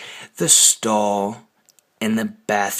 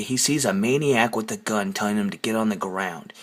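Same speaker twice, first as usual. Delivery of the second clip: ordinary delivery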